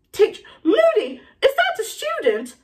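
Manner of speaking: horrified, very high question; aghast, climbing into a high shocked cry; scandalised professor in disbelief; McGonagall. Acted. McGonagall horrified by what she sees.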